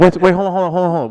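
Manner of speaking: surprised protest. Real, not acted. Shocked interruption, protesting.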